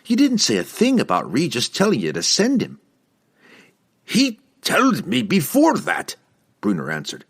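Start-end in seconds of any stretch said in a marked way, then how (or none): 0.0-2.8 higher-pitched, pointed rebuttal; animated correction in a light character voice; arguing daughter. A narrator's reading. Daughter argues back in quarrel. 4.0-6.1 much higher, indignant dwarf voice; cornered dwarf's heated answer; insistent dwarf. A narrator's reading. Bruner argues back heatedly.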